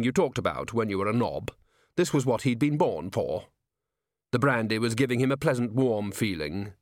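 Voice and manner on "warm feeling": ordinary delivery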